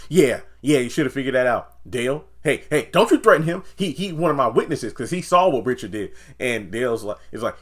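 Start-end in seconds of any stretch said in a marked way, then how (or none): 0.1-6.1 loud, higher yelled imitation; hammed-up fury; mock angry Nancy. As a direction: shout him down. Nancy threatening Sam.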